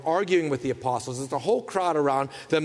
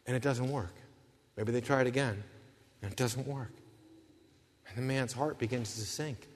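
hushed sinking; 5 semitones lower, 9 dB quieter than usual. A narrator's reading. Sermon, quiet sinking despair.

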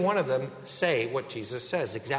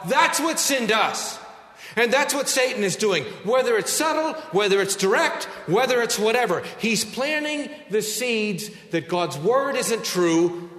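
rising, louder preaching on sin; 5 semitones higher, 3 dB louder than usual. Acted. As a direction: drive it home like a preacher. Preaching at full force.

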